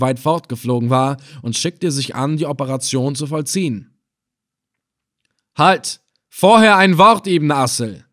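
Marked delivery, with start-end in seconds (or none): 5.6-8.0 loud, urgent interruption; raised voice cutting in; calling out. A narrator's reading. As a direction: call a halt with authority. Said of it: "rief ich jetzt".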